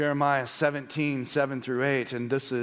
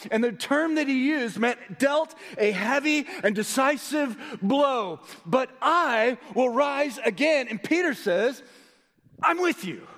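high, raised preacher's voice; full-force pulpit exhortation; impassioned preaching; 12 semitones higher, 5 dB louder than usual. Real, not acted. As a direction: exhort the congregation with rising urgency.

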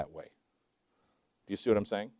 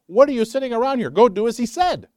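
higher and louder, urgent prodding; preacher voicing the angel scolding the idle apostles, higher and louder; angel's rebuke; 10 semitones higher, 6 dB louder than usual. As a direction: shake them up like an impatient angel. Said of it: "Paraphrase, but that's what he said".

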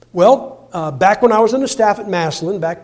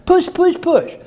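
mimicking a pushy church voice, building up; mock nagging; 8 semitones higher than usual. Preacher mimics the nagging church voice: mock nagging, building.

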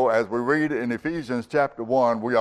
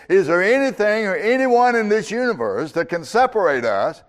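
high, raised preacher's challenge, short of a scream; mock cry of defiance, pitched high and easing at the end; imitated screaming challenge; 5 semitones higher, 5 dB louder than usual. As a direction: call it out to the whole universe, half-shouted. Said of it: "He's screaming out to the universe".

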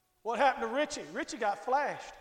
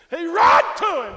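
loud climactic cry of "He run to him"; preaching shout; 3 semitones higher, 12 dB louder than usual. Acted. Preacher crying out to the church that the father ran.

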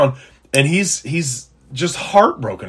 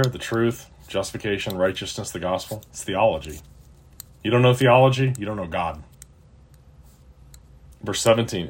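ordinary talk

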